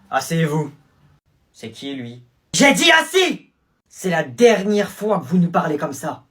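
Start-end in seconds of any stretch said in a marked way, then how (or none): 2.5-3.5 high, loud barked command; stern order. Acted. New teacher ordering the students to sit, loud command.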